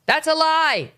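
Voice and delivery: high, mock yell of protest; retelling a heckler's high mock yell; mock scream. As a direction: imitate a heckler yelling it out. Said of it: "started screaming at him".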